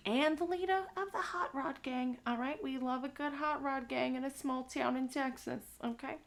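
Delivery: high, bright storyteller voice; character voice. Pretend. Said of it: "I'm glad that you love this voice".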